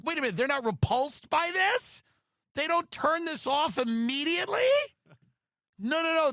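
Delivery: mock CEO surprise. CEOs learning viewers aren't repulsed: high mock amazement. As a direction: climb up in pitch as it dawns on them. CEOs realizing people love it.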